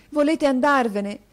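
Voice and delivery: put-on boy pleads with his teacher, urgent. A narrator's reading. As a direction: press him for an answer.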